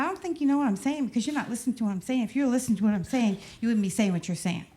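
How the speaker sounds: rapid mock jive, tumbling words; fast jive comeback. Mother out-jiving her daughter, fast and playful. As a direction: rattle off the wordplay quickly. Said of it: "I said".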